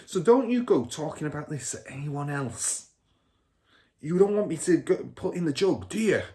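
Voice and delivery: put-on a smug car dealer bragging to his kid; Mr Wormwood's voice. A narrator's reading. Father character voice.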